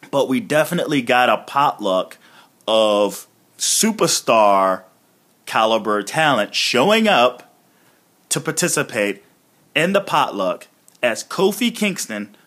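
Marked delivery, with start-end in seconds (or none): none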